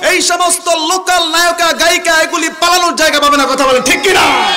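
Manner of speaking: roaring rally cry from the pulpit; full-force preaching. Real, not acted. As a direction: rouse the crowd at the top of your voice.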